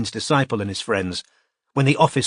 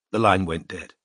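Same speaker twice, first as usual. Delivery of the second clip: ordinary talk, nothing marked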